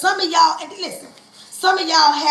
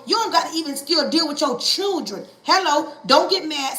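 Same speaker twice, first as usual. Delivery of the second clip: ordinary talk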